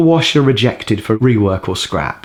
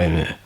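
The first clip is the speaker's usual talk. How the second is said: grunt; 7 semitones lower, 7 dB quieter than usual. Retold or imitated. Jack's grunt retold.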